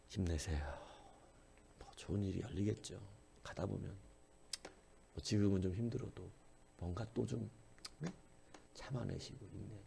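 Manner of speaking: soft, hushed consoling words; gentle comforting. Retold or imitated. Preacher mimics people consoling the weak, softly. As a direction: soothe a struggling friend softly. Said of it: "이렇게 해줘야 되잖아요".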